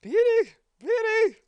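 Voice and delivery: high, desperate yell of a name; anguished wailing shout. Acted. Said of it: "the guy's just yelling".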